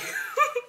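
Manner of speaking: high burst of delighted laughter; excited squeal laugh. Real, not acted. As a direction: squeal with joy at the card. Thrilled at a rare card.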